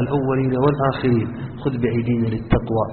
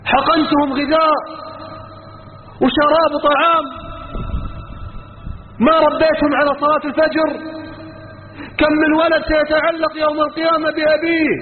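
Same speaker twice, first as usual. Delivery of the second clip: fiery preaching; 13 semitones higher than usual. Real, not acted. Preacher rebuking fathers before the congregation, impassioned high preaching. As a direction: thunder the warning at the congregation.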